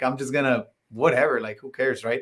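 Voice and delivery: usual speaking voice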